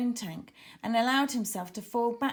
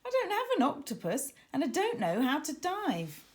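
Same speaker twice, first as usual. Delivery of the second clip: flustered protest; 6 semitones higher than usual. A narrator's reading. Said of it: "exclaimed Tara".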